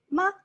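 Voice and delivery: short, light "ma"; touch "ma" very lightly, without stretching it; neutral tone, light. Shown as a demonstration. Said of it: "is very light".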